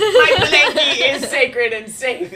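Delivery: loud, drawn-out declaration through laughter; mock-solemn, laughing. Killer conceding to a kid's blanket, laughing mock proclamation. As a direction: stretch it out high and loud, cracking up.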